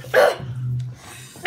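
slow breathless whisper. Real, not acted. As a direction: drop the chant to a whisper. The chant drops to a whisper.

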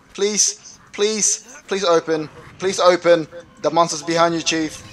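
normal talking voice

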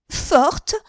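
high, loud, indignant "Forte?" thrown back; outraged echo. A narrator's reading. Henriette repeating the insult to the shopgirl, outraged. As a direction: spit the word back in disbelief.

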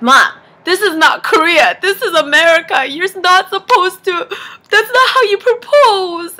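loud, rising mock protest; indignant whine, acted out; retold exasperation. Daughter argues with mom, acted out.

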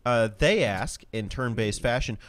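ordinary talk, nothing marked

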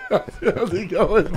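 normal talking voice

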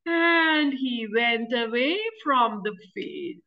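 usual speaking voice

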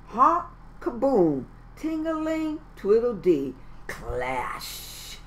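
playful instrument sounds; band noises. Acted. Band plays one last time, playful noises. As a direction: sing out each instrument noise.

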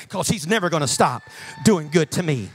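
ordinary talk, nothing marked

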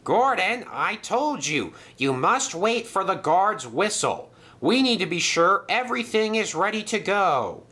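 high, emphatic telling-off; scolding. A narrator's reading. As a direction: scold an employee who jumped the signal. Stationmaster scolds an engine.

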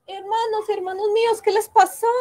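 high, rising alarmed call; shocked, calling them; alarmed cry. Acted. Princess finding her enchanted brothers, alarmed.

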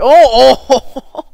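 loud, high startled yell; shocked cry. Real, not acted. TNT goes off, shocked cry. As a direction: cry out at the blast.

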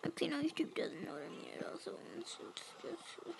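fast unvoiced whisper. Real, not acted. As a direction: whisper the aside quickly. Aside to audience, whispered.

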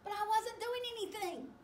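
high, quick protest in a character voice; indignant high-voiced shark.

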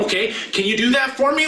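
ordinary talk

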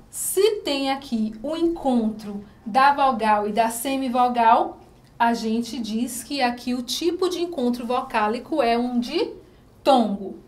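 normal talking voice

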